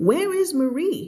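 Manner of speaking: high, urgent question; anxious question. Acted. Teacher voicing a worried friend in the story, high and urgent. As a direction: ask for her in alarm.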